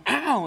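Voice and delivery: high, loud mock "ow"; a child's sudden loud ouch; retold yelp of pain. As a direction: yelp like a kid whose hand got squeezed. Child grandpa squeezes his hand.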